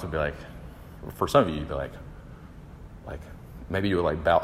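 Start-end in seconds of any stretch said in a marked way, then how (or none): none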